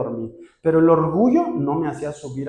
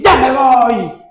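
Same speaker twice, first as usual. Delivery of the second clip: a slow, much louder and higher furious shout; angry exit; 10 semitones higher, 11 dB louder, much slower than usual. Retold or imitated. Husband yelling at his wife as he storms out, furious. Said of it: "me desperté bien enojado".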